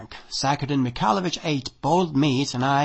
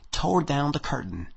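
ordinary delivery

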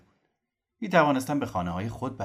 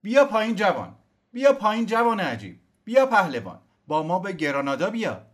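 high, loud call up a tree; shouting up; 12 semitones higher, 9 dB louder than usual. Acted. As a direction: call the young man down. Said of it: "رو به کوزیمو فریاد زد".

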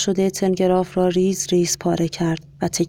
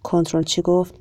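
plain reading voice

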